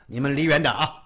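ordinary delivery, nothing marked

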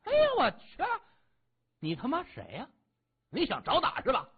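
high, loud outburst of outrage; furious bully picking a fight; enraged thug. A narrator's reading. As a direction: explode at him, voice jumping up. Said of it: "看样子是恼羞成怒了".

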